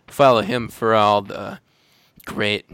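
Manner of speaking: ordinary talk, nothing marked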